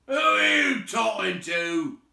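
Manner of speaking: loud, high troll shout. A narrator's reading. William shouting at Bert. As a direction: bellow the question. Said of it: "said William".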